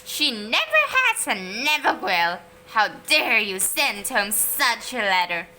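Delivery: loud, indignant scolding; outraged mother. A narrator's reading. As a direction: tell the teacher off, loud and offended. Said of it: "shrieked Moody Margaret's mother".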